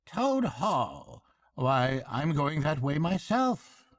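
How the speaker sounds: high, hearty barge woman; bright country woman chatting. A narrator's reading. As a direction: barge woman offering a lift. Barge woman voiced in story.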